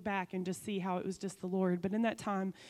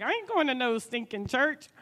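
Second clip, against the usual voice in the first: louder, mock-gruff refusal; husband's stubborn refusal; 3 semitones higher, 7 dB louder than usual. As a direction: play the stubborn husband, hamming it up. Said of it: "He was like".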